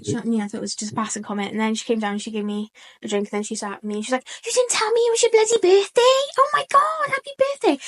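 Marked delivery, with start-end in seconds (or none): 4.4-7.8 high-pitched excited squeal of a friend; imitating an overexcited friend; excited mock outrage. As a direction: gush with shocked birthday excitement, much higher. Retelling friend's birthday reaction.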